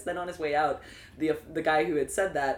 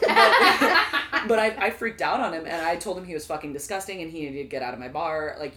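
ordinary talk

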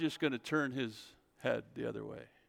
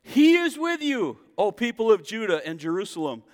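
a preacher crying out the promise; preaching at full force; 8 semitones higher, 7 dB louder than usual. Acted. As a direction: proclaim it to the whole church.

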